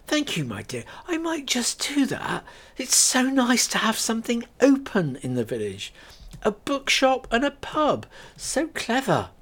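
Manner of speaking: high, fluttery praise; charmed village lady; older lady voiced, delighted. Lady delightedly praises Kate's bookshop and pub.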